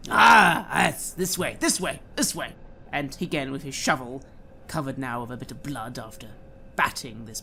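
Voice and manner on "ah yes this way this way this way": loud outburst, then eager repeated urging; eager henchman. Acted. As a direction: usher visitors along like an excitable servant. Otto eagerly leads the visitors.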